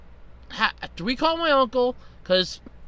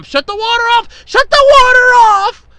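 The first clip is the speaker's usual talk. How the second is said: full-throated frantic yell, much higher and louder; yelling at the top of lungs; 11 semitones higher, 11 dB louder than usual. Retold or imitated. Yelling to his mom to shut the water off, frantic scream. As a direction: scream it to be heard over the water. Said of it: "I'm yelling at the top of my lungs to my mom".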